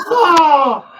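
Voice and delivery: high, loud mock outcry; big, high "oh" of reaction; group outcry. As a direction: imitate a crowd erupting. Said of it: "all the rest of them go".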